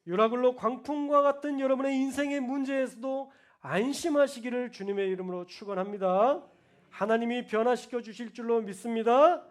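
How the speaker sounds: loud rising proclamation; preaching at full force. Acted. Pastor blessing the congregation, loud. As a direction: proclaim it over the crowd.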